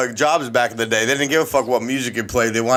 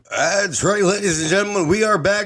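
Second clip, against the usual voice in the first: announcer voice; 7 semitones higher than usual. Acted. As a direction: introduce the show like a ring announcer. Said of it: "your homeboy got a official fucking announcer voice".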